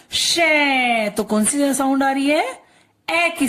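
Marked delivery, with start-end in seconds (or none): 0.1-1.1 शै held long, gliding down; drawn-out syllable. Shown as a demonstration. Teacher pulling the sound out of the name, drawn-out शै. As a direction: isolate and stretch शै. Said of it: "हम बोलते हैं ना".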